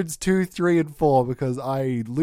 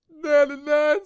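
mock crying; 10 semitones higher, 3 dB louder than usual. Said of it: "This is me really crying".

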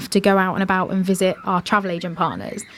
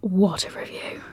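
near-whispered amazement; hushed awe; 6 dB quieter than usual. Real, not acted. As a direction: breathe it out softly, impressed. Awed reaction to the review.

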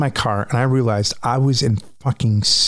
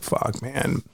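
muttered under the breath, much softer; quiet curse; 7 dB quieter than usual. Retold or imitated. Hearing the ambulance siren, a quiet dismayed curse. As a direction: let it slip out quietly in dismay. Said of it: "I'm like".

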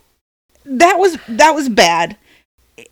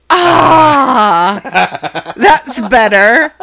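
mock relief; 4 semitones higher, 5 dB louder, much slower than usual. Son relieved after a fart.

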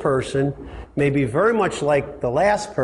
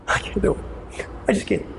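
whispered, higher mock whimper; mock faint-hearted; 6 semitones higher, 3 dB quieter than usual. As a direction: play the faint-hearted one giving up. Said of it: "faint-hearted".

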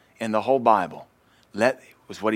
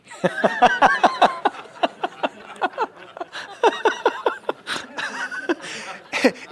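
long real laughing fit; can't stop laughing; high helpless laughter. Real, not acted. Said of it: "It's just so funny".

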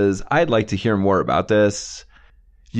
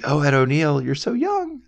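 put-on gushing swoon, rising high on young; fawning over a young star, half joking; mock gushing; 5 semitones higher than usual. Podcaster recalls swooning at young Ed O'Neill, mock gushing. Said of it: "I was like".

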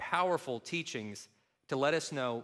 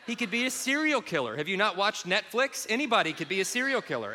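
ordinary talk, nothing marked

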